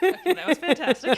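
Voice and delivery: high, giggly voice still recovering from a laugh. Real, not acted. Co-host praising a friend's movie joke, laughing. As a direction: praise it through real laughter.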